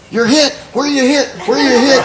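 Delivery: high, loud, urgent; urgent medic shout. Retold or imitated. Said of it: "the medic gets to us".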